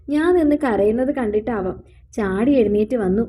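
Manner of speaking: ordinary delivery, nothing marked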